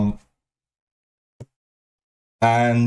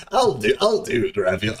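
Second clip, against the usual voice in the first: imitated Aiden. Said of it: "Aiden fucking says".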